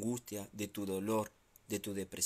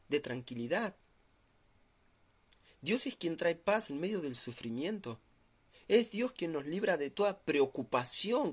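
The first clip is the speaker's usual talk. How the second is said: raised, pleading sermon voice; fervent preaching; 5 semitones higher than usual. Acted. Pastor urging the hurting to rise, raised fervent preaching. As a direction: preach it out high and urgent to lift them.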